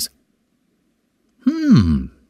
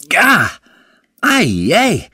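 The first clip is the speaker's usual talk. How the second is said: startled gah; 9 semitones higher, much slower than usual. Acted. Biting sticky rice cake.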